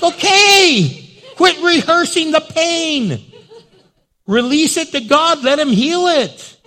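high, loud preacher's shout; full-voiced pulpit yell; exasperated exhortation. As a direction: holler it slowly, driving every word home. Preacher exhorts people stuck rehearsing pain.

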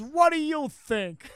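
loud, slow, high-pitched echo of a movie line; hammed-up rude reply; mock scornful retort. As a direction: draw out the sarcastic comeback. Listener repeats the rude movie line.

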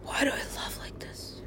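soft breathy whisper to self. Real, not acted. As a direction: whisper it, barely voiced. Unvoiced whisper heard.

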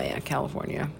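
ordinary talk, nothing marked

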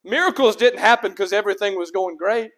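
usual speaking voice

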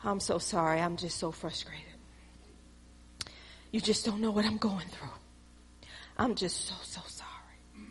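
hammed-up sorry, trailing to a breath; mock whiny apology. Preacher mocking a believer's fake sorry, whimpering softly.

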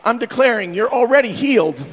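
loud, ringing sermon delivery; full-force preaching. Acted. Pastor preaching loudly to the congregation. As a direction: preach it out to the whole church.

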